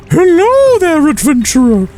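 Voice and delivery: high, loud, hammy monster greeting; hungry monster voice. Pretend. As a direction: play a gleeful cave monster about to eat you. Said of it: "And they can go".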